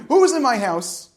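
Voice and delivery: loud, high frightened calling out; screaming. Retold or imitated. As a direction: call through the house, alarmed and loud. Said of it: "walked around his house screaming".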